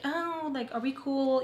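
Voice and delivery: high, soft mimicry of a chummy friend; mock friendly. Imagined friend checking if they're cool, mock friendly. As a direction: put on a high, sweetly casual check-in.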